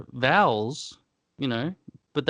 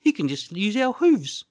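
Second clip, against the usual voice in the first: talking pig; 10 semitones higher than usual. Acted. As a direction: play a smug little pig. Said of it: "and they say".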